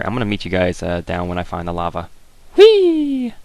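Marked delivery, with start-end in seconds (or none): 2.6-3.3 very high, loud gleeful squeal; whee. Real, not acted. Dropping down the cave hole, gleeful squeal. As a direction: squeal as you drop.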